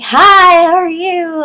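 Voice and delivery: high, drawn-out hello; gushing greeting. Retold or imitated. Vlogger retelling her hello at meet and greet, gushing. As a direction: greet them in a high, singing gush. Acting out own excited greeting.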